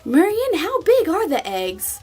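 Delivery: high, squeaky disbelief; incredulous. Retold or imitated. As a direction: ask it in wide-eyed shock. Retold incredulous self, high voice.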